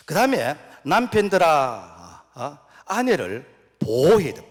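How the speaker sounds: loud, slow preaching command; preaching exhortation. Real, not acted. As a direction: charge the husbands firmly. (남편들에게 크고 느리게 외치는 설교)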